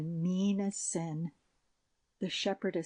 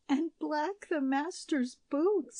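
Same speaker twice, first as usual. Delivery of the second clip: a touch of an earnest shepherdess; 9 semitones higher, 3 dB louder, slower than usual. A narrator's reading. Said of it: "said the shepherdess".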